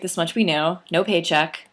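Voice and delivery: plain reading voice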